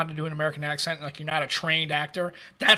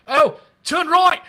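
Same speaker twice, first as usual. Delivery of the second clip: high, loud, slow Scottish navigator; mock Scottish accent; 10 semitones higher, 9 dB louder, much slower than usual. Retelling a comic's navigation bit, loud Scottish accent. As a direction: bellow directions in a hammed-up Scottish accent. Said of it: "or a Scottish guy like".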